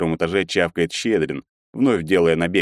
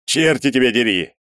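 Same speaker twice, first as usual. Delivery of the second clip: louder, raised curse; exclamation; 6 semitones higher, 4 dB louder than usual. A narrator's reading. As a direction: swear at the news feed. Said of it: "иногда восклицал он".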